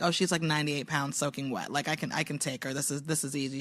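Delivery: low, soft aside to herself; quietly self-assured retold thought; inner thought. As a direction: voice a private sizing-up under the talk. Retells her private sizing-up thought.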